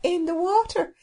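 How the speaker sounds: cheery, folksy servant with dialect vowels; happy cook. Acted. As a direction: chatter blithely, high at the exclamations. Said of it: "replied the cook happily".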